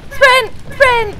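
high, loud cry to run; shouted urging. Real, not acted. Urging the game chicken to run, shouted high. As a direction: yell at it to sprint.

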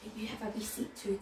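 ordinary delivery

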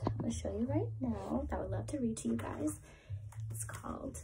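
put-on fast secretive whisper to the kids. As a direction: let it slip out like a little secret. Teasing the book to children.

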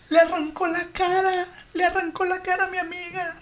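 high, sobbing panicked report; crying panic. Retold or imitated. Caller describing the attack to the operator, crying. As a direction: imitate a hysterical caller crying. Retold frantic 911 call.

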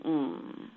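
a deep, soft groan from the creature; low groan. A narrator's reading. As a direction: groan low and weary, like something dying. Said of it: "and heard it groan".

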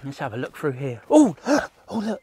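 ordinary talk

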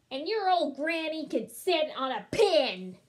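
high, loud insulting shout; taunting yell. Acted. Third pig insulting wolf's granny, yelling. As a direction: yell the insult, landing hard on the end. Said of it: "the third little pig yelled".

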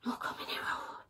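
whispered, barely voiced; mumbled. Badger mumbling to himself while Skunk chatters, whispered. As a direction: mumble it to yourself. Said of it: "Badger mumbled".

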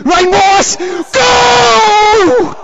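high excited yell; goal shout. Real, not acted. Celebrates a goal too early.

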